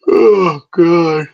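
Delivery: loud, high anguished "Oh, God" breaking into a cry. Real, not acted. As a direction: let it burst out as a real groaning cry. Exhausted rant about working.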